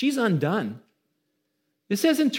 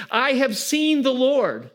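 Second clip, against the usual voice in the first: joyful proclamation; 7 semitones higher, 4 dB louder, slower than usual. Acted. Mary's announcement to the disciples.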